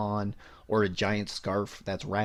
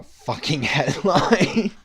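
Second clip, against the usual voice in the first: laughing; 5 semitones higher, 8 dB louder, much slower than usual. Real, not acted.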